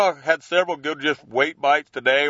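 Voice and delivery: normal talking voice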